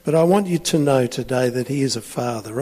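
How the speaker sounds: normal talking voice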